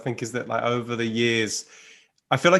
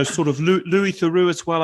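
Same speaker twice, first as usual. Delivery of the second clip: ordinary talk, nothing marked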